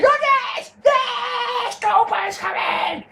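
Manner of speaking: very high squealed shouting, re-enacted; hammed-up fan losing it with joy; excited fan. Him and his mate reacting to the Goldberg chant, wildly excited. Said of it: "looking at each other going".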